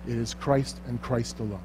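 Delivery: ordinary delivery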